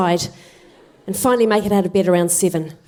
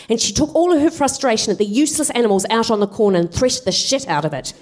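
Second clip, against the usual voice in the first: normal talking voice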